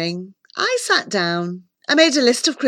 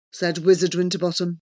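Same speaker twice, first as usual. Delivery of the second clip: ordinary delivery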